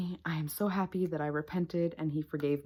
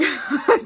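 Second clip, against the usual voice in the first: short laugh; 10 semitones higher, 8 dB louder than usual. Real, not acted. Laughs at own drama.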